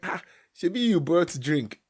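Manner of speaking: high, loud jolly dad; cheerful dad. Retold or imitated. Dad turning the night into a party, loud and jolly. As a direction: boom out the invitation like a happy father. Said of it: "He was like".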